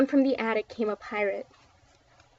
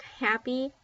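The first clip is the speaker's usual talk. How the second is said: plain reading voice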